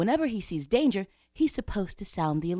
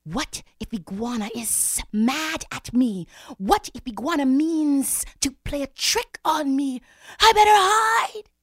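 high, stretched fretting that builds louder; worried; 8 semitones higher, 7 dB louder than usual. A narrator's reading. Worried Snake fears Iguana's trick, mounting alarm. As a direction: work up from worry to a panicked cry. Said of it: "Snake got worried".

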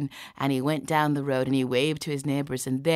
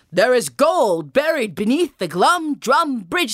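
slow, loud, high swooping proclamation; theatrical, drawn-out dream message; mysterious dream voice; 9 semitones higher, 9 dB louder than usual. Acted. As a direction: intone it slowly like a prophecy. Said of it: "I hear this voice".

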